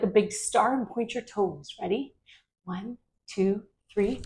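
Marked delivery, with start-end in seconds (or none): none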